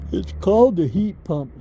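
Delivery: talking through a yawn; words stretched by a yawn. Real, not acted. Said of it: "Excuse me for yawning".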